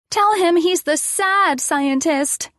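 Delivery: put-on high, slow child's voice; little girl. A narrator's reading. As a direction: coach a grown-up in the game. Addison instructs the narrator.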